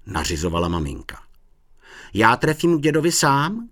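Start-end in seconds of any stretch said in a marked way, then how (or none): 2.1-3.7 put-on high, louder boyish bragging; boasting. A narrator's reading. Honzík claims he can find grandpa alone, boasting. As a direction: show off with cocky confidence. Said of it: "kasal se Honzík".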